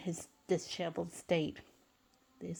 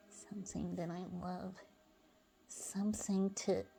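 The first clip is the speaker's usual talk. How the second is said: much softer, fading to a whisper; murmured to himself; 6 dB quieter than usual. Acted. SpongeBob alone after Sandy leaves, soft whispered murmur. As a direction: murmur it to yourself, barely voiced.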